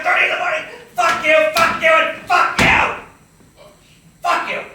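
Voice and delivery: very loud, high furious screaming; all-out screaming fit at the boss; screaming rage. Retold or imitated. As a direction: scream every insult at full force.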